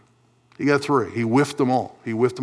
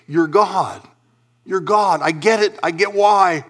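raised, emphatic cry of understanding; acted realisation; 7 semitones higher, 4 dB louder than usual. The young man finally grasping who Jesus is, high and emphatic. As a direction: say it as the truth suddenly lands. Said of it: "out of your mouth should be".

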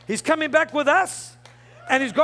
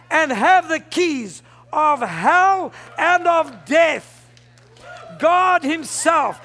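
slow, shouted pulpit proclamation; preaching at full force; slower than usual. Real, not acted. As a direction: hammer each key word, stretched out. Preacher building to a shouted climax.

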